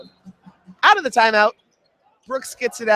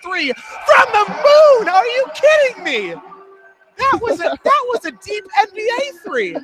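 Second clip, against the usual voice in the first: excited call; 11 semitones higher than usual. Real, not acted. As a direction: call it like the crowd just jumped up. A deep three goes in.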